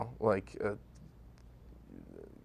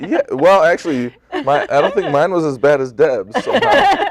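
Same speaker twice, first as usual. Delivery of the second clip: laughing; 7 semitones higher, 9 dB louder than usual. Real, not acted. Laughing about character sheets.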